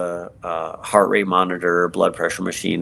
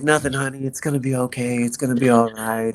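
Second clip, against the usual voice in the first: higher, soothing mother's reassurance; mother telling her son it will be okay, higher and gentle; gently voiced mom; 5 semitones higher than usual. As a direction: comfort your son lightly in mom's voice.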